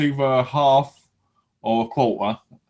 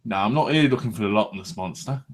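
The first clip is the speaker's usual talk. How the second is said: ordinary talk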